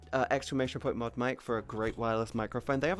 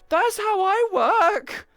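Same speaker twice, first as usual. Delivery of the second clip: high, slow, loud proud boast; proud declaration; 9 dB louder, much slower than usual. Real, not acted.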